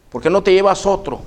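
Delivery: a hint of a skeptical clerk.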